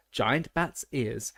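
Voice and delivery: usual speaking voice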